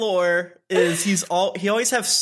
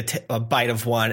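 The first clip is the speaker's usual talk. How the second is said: normal talking voice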